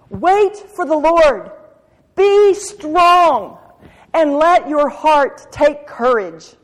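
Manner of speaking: fervent exhortation; slow emphatic preaching. Real, not acted. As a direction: proclaim every word slowly. Preaching the verse.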